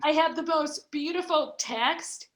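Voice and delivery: emotional. Real, not acted. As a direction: push on through welling emotion. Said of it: "not to get emotional".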